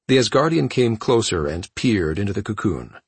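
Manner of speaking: plain reading voice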